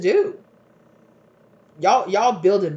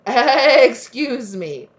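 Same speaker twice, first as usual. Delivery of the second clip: long loud "hey", then slower; mighty call-out; 5 semitones higher, 6 dB louder, much slower than usual. Retold or imitated. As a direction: call them out like a powerful angel. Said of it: "came to this person and was like".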